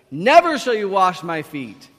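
loud, emphatic refusal; arguing. Acted. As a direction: protest forcefully as stubborn Peter. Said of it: "he argues with Jesus".

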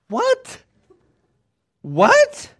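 high, rising mock-astonished "what?"; imitating a man who can't believe who called; stunned disbelief. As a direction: squeak it out in comic shock. Acting shocked reaction to famous caller.

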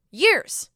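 Years stretched out loud and high; emphatic. Real, not acted. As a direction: hit the word Years hard. Stressing long wait.

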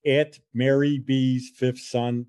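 slow, careful spelling-out of the handle. Shown as a demonstration. Host reading the Instagram handle, slow and careful. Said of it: "I always get stuck at".

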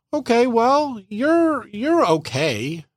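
high, slow, grudging faint praise; damn him with faint praise; lukewarm reply. Retold or imitated. Partner answers a heartfelt compliment with slow high grudging praise.

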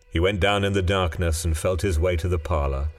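plain reading voice

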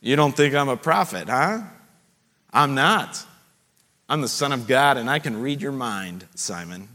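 low, sinking voice fading almost to nothing on Simon; Jesus teasing Simon with a low, fading mock retort; mock Jesus comeback. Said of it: "he didn't quite say it like that".

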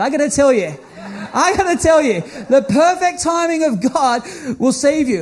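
normal talking voice

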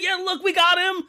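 high, rushed, panicky surrender plea; frantic plea. Acted. Regime official frantically pleading with the Allies, high and rushed. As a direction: squeal it fast, like a begging official.

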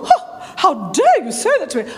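hammed-up pious outrage; mock-scandalised, with swooping pitch; religiously offended. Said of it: "if I was all religious and all".

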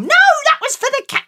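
high, fast exasperated retort; exasperated mum. Acted. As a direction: snap back, exasperated.

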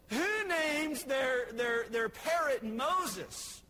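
loud, drawn-out comic disbelief; bewildered. Acted. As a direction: stretch the question out in mock bewilderment. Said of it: "He's kind of bewildered".

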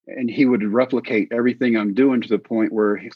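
ordinary talk, nothing marked

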